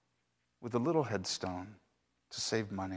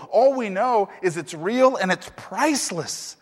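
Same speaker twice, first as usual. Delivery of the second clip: higher and much louder, breathless with astonishment; 7 semitones higher, 8 dB louder than usual. Acted. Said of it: "they're very, very shaken".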